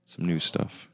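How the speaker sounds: ordinary delivery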